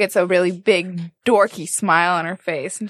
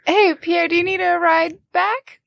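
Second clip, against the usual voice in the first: friendly call; 9 semitones higher than usual. Acted. Joe calls out offering ride.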